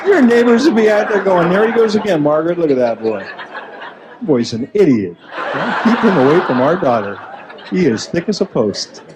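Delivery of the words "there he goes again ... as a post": low, lightly voiced neighbor gossiping; nosy neighbor telling Margaret about the boy, low and gossipy. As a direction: mutter it like a nosy neighbor to his wife.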